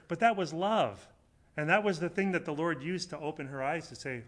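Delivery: normal talking voice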